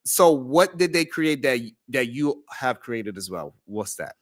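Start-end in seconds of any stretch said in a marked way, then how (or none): none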